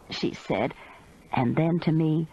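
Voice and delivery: ordinary delivery, nothing marked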